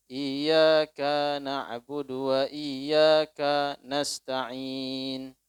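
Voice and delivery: "iyyaka" slow, the kaf split off only slightly; thin separation. Shown as a demonstration. Preacher demonstrates the correct thin split, slow. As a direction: recite "iyyaka na'budu" slowly with a light break at the kaf. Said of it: "Padahal tidak panjang, tipis dia".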